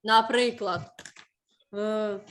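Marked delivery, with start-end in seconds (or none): none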